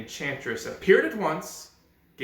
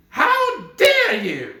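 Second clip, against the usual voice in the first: high, loud, slow furious witch voice; angry enchantress; 12 semitones higher, 11 dB louder, slower than usual. Acted. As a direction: spit it out like a wrathful witch. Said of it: "said she with an angry look".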